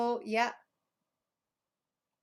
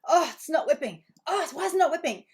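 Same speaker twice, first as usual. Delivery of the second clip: mock frustrated baker; 6 semitones higher, 6 dB louder than usual.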